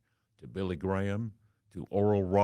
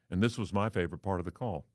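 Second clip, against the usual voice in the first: ordinary talk, nothing marked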